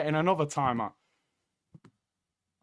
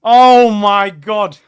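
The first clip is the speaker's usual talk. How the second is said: loud, drawn-out disbelief; stunned cry; 3 semitones higher, 14 dB louder, much slower than usual. Real, not acted. Fan reacting to a goal, stunned cry. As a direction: let each word stretch out loudly.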